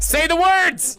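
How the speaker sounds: high, slow insistent order; commanding shout. Real, not acted. Streamer ordering his friend to deliver the insult, commanding shout. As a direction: demand it slowly and loudly. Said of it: "This is an announcement".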